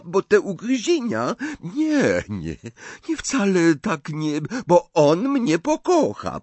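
put-on a young French bride, wounded and defiant; Fleur, French accent. A narrator's reading. Fleur answers Mrs Weasley with a high French accent.

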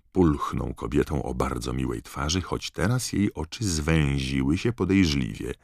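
ordinary delivery

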